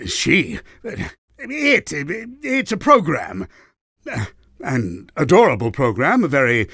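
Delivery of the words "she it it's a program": higher, slow, stammered backtrack; flustered correction. Acted. Villain catches himself calling the program she, flustered. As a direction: stumble over it, covering up affection.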